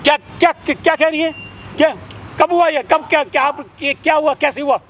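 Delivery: shocked outburst. Acted. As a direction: sputter in alarm at the news. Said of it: "आप इतने hyper क्यों हो रहे हो?".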